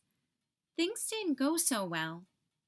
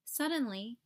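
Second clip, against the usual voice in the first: plain reading voice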